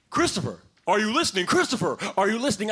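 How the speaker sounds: repeated stern classroom callout; teacher calling out. Acted. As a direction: call the daydreaming kid back. Teacher calls distracted pupil.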